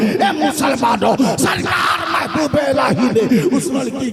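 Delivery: loud, high preaching build over music; preaching at full force. Acted. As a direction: drive the refrain home to the crowd. (Josheela was, ऊँची आवाज़ में)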